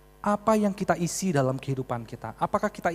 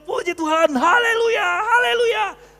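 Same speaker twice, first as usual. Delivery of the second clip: very high, drawn-out jubilant shouts; shouting for joy; 13 semitones higher, 10 dB louder, much slower than usual. Retold or imitated. Pardoned motorist praising God before the policeman, shouting for joy. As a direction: cry out praise at full, hammed-up pitch. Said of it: "Saya teriak-teriak".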